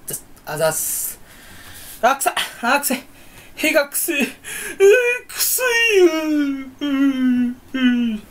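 high, whiny hammed-up sob; mock crying over fart. Vlogger crying over his own fart, hammed-up high wail. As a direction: wail like a baby at the smell.

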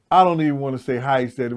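usual speaking voice

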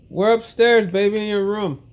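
high, loud call. Real, not acted. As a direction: call out so she can find them. Dad calls where they are.

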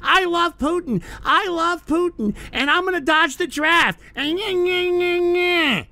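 high, mocking voice of a draft-dodging Putin fan. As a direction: sneer it like a dumb Putin fanboy. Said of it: "out there saying".

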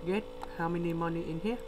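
ordinary delivery

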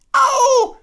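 very high, loud squeal; shrieking ow. Acted. Piglet shrieking as Kanga scrubs him. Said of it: "schrie Ferkel".

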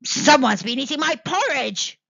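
loud growling bear voice; growling Father Bear. Acted. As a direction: growl it like a big angry bear. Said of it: "growled Father Bear".

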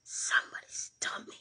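whispered catchphrase impression; Jim Carrey. Streamer quoting a film star to the chat, whispered impression. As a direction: hush it right down into a theatrical whisper. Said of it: "Um, Jim Carrey".

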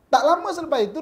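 ordinary talk, nothing marked

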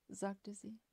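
plain reading voice

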